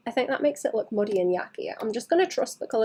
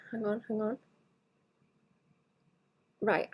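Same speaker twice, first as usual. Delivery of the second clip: ordinary talk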